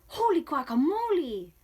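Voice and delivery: high, loud burst of astonishment; exclaiming. A narrator's reading. Kitten startled by his mirror reflection, astonished exclamation. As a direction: exclaim it big, with a swooping pitch. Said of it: "exclaimed Skippy John Jones".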